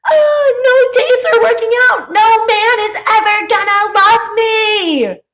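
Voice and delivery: high, loud mock wailing; drawn-out, over-the-top lovelorn howl; dramatic meltdown. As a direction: wail it on a hammed-up dramatic scale. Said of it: "picture it on a dramatic scale".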